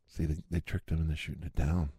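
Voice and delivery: ordinary delivery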